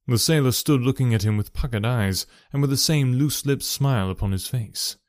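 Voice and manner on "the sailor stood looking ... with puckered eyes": plain reading voice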